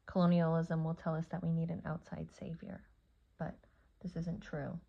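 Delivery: normal talking voice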